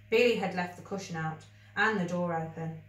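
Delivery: ordinary delivery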